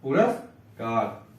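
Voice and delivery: पुरस्कार stretched out slowly; teacher drawing out पुरस्कार for the class; slow model word. Shown as a demonstration. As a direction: spell out पुरस्कार by sound, slowly. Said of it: "पुरस्कार आधा सा होता है".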